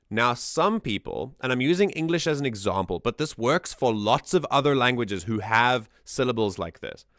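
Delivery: normal talking voice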